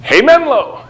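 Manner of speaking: cheerful call across the open office. Shown as a demonstration. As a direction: sing out Hey, Menlo to the whole room. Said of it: "This is the way it works".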